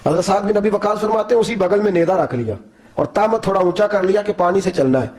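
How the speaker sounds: ordinary talk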